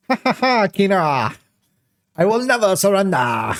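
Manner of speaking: hammed-up cartoon villain laugh; mustache twirling villain. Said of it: "Mustache twirling".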